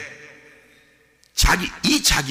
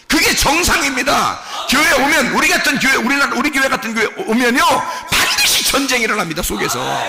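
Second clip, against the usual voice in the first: emphatic sermon climbing high; full-force preaching; 9 semitones higher, 6 dB louder than usual. Real, not acted. Preacher describing the war between false and true self, full-force preaching. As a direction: proclaim it with growing intensity.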